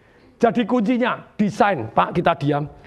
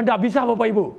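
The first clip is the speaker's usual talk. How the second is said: usual speaking voice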